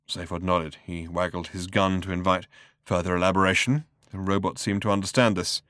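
usual speaking voice

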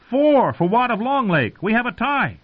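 very high, called-out score; joking high-pitched proclamation; tourney announcer. A narrator's reading. Pip mimics tourney scorer aloud.

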